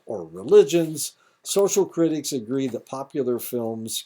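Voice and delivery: loud, high formal reading voice; reading aloud. Acted. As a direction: read it out like a lecture text. Reads a passage aloud.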